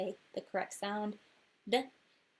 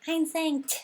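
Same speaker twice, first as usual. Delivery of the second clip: mock student voice; 7 semitones higher, 7 dB louder than usual. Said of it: "you may not be like".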